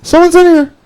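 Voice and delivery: high squeaky falsetto shriek; mock scream. Man on the toilet warning the knockers, squeaky mock scream. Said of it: "screaming off the top of my lungs".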